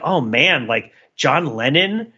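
usual speaking voice

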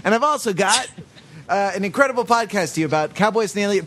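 high, fast radio promo read; announcer voice. Acted. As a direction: pitch the segment like a radio host. Announcer read before segment.

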